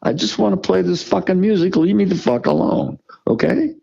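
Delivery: rising, heated protest; getting worked up, voice climbing high; defiant rant. Real, not acted. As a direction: push back hard, pitch climbing. Defiant outburst about himself.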